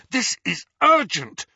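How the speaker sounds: high, loud, urgent insistence; fierce. A narrator's reading. Harry insists to the ghost it is urgent, fierce. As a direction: press it on them fiercely. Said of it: "he said fiercely".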